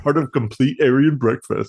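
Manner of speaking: commercial tagline read; cereal mascot. Pretend. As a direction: say it like a cereal slogan. Echoes commercial slogan.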